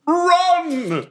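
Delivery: high-pitched, drawn-out battle cry; dramatic shout. Acted. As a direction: bellow a long, theatrical order to flee. Character orders escape from pursuers.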